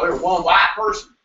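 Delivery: put-on Southern accent. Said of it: "I shouldn't do the accent".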